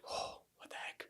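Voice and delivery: slow, hushed inner reaction; whispered bewilderment. Retold or imitated. Son caught in father's long hug, whispered bewilderment. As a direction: whisper it as a private startled thought.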